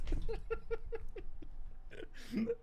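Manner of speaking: high breathless wheeze of laughter; silent wheezing laugh. Real, not acted. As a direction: laugh without voice, crying with it. Laughing at dad story.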